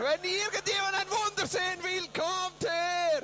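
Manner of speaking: high public shout; crowd-summoning call. Retold or imitated. Interpreter as son to onlookers, high shout. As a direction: call everyone over.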